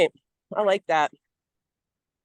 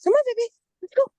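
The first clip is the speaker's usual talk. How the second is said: much higher, sing-song call to a pet; calling the dog; 4 dB louder, faster than usual. Real, not acted. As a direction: coax the dog along.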